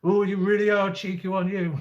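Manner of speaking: doing the copper's teasing voice; amused policeman. Retold or imitated. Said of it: "And he goes".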